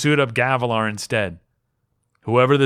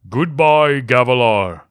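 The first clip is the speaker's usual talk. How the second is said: louder, ringing goodbye; a spirit's parting pronouncement, louder; louder, grave farewell; firm farewell; 6 dB louder, slower than usual. Acted. Stormfather farewell.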